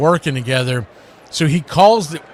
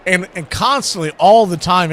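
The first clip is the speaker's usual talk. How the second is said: usual speaking voice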